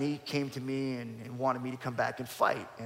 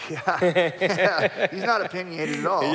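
sarcastic laughing; 4 semitones higher, 9 dB louder, slower than usual. Real, not acted. Joking about quiet boss.

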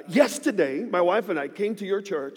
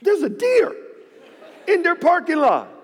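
much higher, louder astonished cry; amazed exclaim; 11 semitones higher, 4 dB louder than usual. Retold or imitated. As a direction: gasp it out like you can't believe it. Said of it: "and I'm like".

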